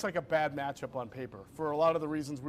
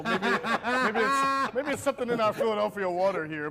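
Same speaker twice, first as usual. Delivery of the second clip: laughing; 5 semitones higher, 6 dB louder than usual. Real, not acted. Laughing joke about Philadelphia water.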